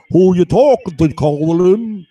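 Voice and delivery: put-on taunt, loud at the start; mocking heckler. Watcher talks ultrasonically to man at home, mocking heckler. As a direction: jeer it as the imitated watcher. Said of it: "talking to me ultrasonically, saying".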